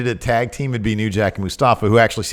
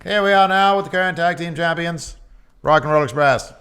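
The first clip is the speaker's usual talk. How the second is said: put-on higher, old-time mid-Atlantic announcer voice; mid-Atlantic announcer accent; 6 semitones higher than usual. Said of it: "I think it's mid-Atlantic".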